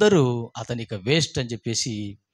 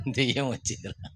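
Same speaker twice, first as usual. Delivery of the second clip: scared hush; 7 dB quieter than usual. Retold or imitated. Men fear a ghost outside.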